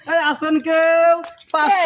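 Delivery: long drawn-out street vendor's cry; hawker's call. Acted. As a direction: call out for customers, stretching the last word. Hawking for customers in the street. (ফেরিওয়ালার মতো টেনে টেনে হাঁক)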